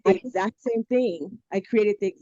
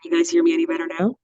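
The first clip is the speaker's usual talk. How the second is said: tin can voice; 5 dB louder, much faster than usual. Real, not acted. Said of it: "It's a little bit better".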